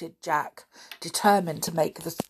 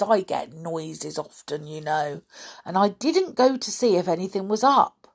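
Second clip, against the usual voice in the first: lightly voiced grumbling old man; old caretaker; 4 semitones higher, 6 dB louder than usual. A narrator's reading. Caretaker brushing off the boys' questions, dismissive. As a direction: shrug it off as an old man would.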